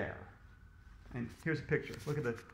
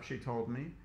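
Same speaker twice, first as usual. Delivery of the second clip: ordinary delivery, nothing marked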